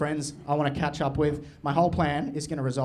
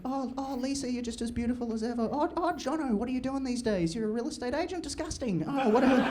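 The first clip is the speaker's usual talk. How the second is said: higher, softer airy schmoozing; mock charming self; 8 semitones higher, 5 dB quieter than usual. Greeting old friends at reunion, higher softer smug charm. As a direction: breeze through the room like a smug celebrity. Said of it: "talk to all the girls".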